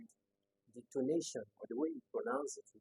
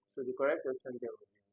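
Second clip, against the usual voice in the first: ordinary talk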